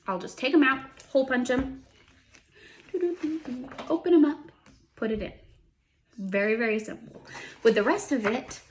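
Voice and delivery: ordinary talk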